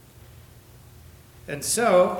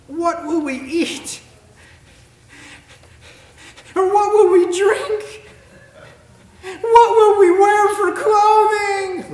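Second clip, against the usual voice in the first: very high, loud, slow whining; mock the frantic worrier, drawn out high and loud; mocking anxious whine; 14 dB louder, slower than usual. Reading worried voices mockingly.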